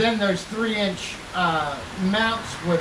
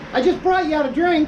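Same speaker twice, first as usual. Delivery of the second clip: line demo; 8 semitones higher than usual. Shown as a demonstration. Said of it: "you got this".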